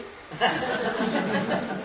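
high laugh at his own joke; a real chuckle after the punchline; amused laugh. Real, not acted. Teacher laughs at the rabbi joke he just told, amused.

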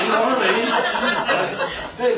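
normal talking voice

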